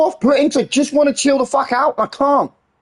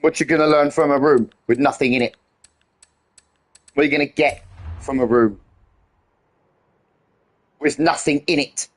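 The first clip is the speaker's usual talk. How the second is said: imitating Paul; 8 semitones lower than usual. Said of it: "I kinda feel like Paul".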